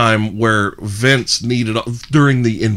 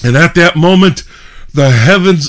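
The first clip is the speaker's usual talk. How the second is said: loud, raised storytelling boom; wrestler's promo; 7 semitones higher, 8 dB louder than usual. Retold or imitated. Retelling the promo as the heavens open, loud and raised. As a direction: proclaim it like a ring promo. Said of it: "And he goes".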